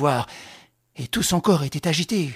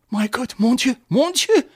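panicked cry climbing much higher; trembling terror; 7 semitones higher, 4 dB louder than usual. Acted. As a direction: shake like a leaf as it rises. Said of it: "qui tremblait comme une feuille".

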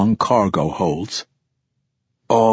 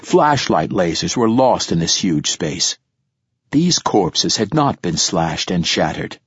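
usual speaking voice

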